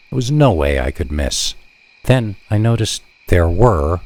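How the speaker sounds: ordinary delivery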